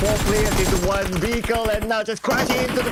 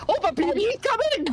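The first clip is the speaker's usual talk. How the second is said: excited; 11 semitones higher than usual. Real, not acted. Caster sees surprise attack.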